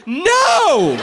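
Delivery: high, loud mock shout of no. Comic answering his own question for the crowd, a high loud mock shout. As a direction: yell it back at the crowd.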